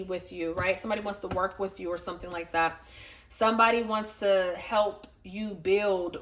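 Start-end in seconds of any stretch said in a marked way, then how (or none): none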